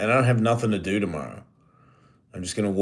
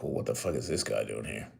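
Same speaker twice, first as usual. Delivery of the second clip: low, soft mock of a dismissive organizer; lightly voiced organizers who don't want him there; mock sneering organizer; 9 semitones lower, 7 dB quieter than usual. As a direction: sneer it under your breath as them. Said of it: "then they were like".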